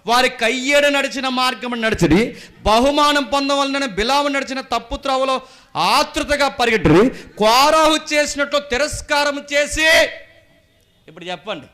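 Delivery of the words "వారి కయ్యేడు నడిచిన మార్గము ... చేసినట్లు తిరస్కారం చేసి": loud, high-pitched scripture reading; full-force preaching. Acted. Preacher reading a verse about those who went astray, shouted.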